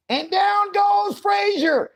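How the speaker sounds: stretched high fight-call shout; announcer call. Pretend. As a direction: bellow it like a ringside knockout call.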